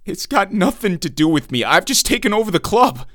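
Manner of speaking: plead, voice rising in fear; begging. Acted. Bound man begs the intruder, frightened and rising.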